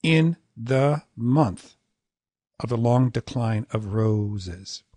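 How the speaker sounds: "In the month" in slow, separate long beats; "In the month of the long decline of roses" slowly scanned; rigid meter. Shown as a demonstration. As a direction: stretch In and the as heavily as month. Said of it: "So you started off with".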